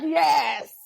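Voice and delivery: a laughing, shouted yes; gleeful admission of speaking another language. Real, not acted. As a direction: burst out yes through laughter. Proud answer, laughing.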